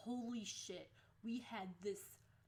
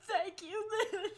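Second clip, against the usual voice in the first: high, loud, overcome with laughter; laughing thanks; 11 semitones higher, 11 dB louder than usual. Retold or imitated. Student to the kid who defended her, laughing loudly. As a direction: blurt thanks through helpless laughing.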